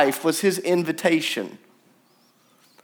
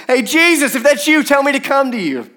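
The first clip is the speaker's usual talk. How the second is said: Peter calling across the water, higher and louder; 9 semitones higher, 6 dB louder than usual. Acted. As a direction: shout out to Jesus over the waves.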